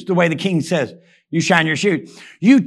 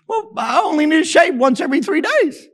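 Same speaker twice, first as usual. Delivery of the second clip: timid recruit excuse; 7 semitones higher than usual. Retold or imitated.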